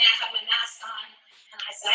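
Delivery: usual speaking voice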